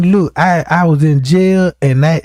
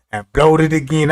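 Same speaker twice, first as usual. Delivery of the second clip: ordinary talk, nothing marked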